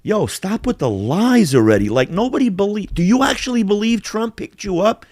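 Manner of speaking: retold exasperated disbelief, pitched high; incredulous challenge. Friend confronting a pathological liar, incredulous.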